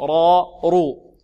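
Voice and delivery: را and رو said loud and heavy. Shown as a demonstration. Teacher demonstrating the heavy ra. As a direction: show the heavy ra. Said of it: "تفخيم".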